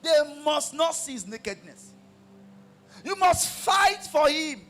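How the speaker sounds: high, loud, shouted sermon; fiery preaching. Acted. Pastor exhorting wives to support husbands, shouted and high.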